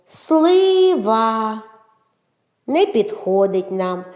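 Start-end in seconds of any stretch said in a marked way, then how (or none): 0.1-1.6 Слива in two long, held syllables, starting high; протяжно по складах. Shown as a demonstration. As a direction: sound out Слива with stretched vowels. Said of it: "в назві яких є звук О". («Слива» протяжно, по складах, починаючи високо)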